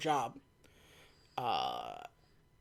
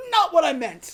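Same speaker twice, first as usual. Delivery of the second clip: mock exasperation; 7 semitones higher, 4 dB louder than usual. M's exasperated reply, acted.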